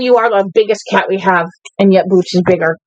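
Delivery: ordinary talk, nothing marked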